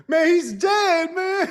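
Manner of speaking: high, loud, drawn-out alarm; mock panic. Podcaster as gangster reporting a death, high mock panic. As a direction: wail it like a spooked henchman.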